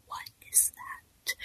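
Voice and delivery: breathless, awed whisper; amazed whisper. Retold or imitated. Student marvelling at cave photos in a hushed whisper. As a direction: whisper it like you've just seen something magical.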